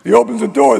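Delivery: usual speaking voice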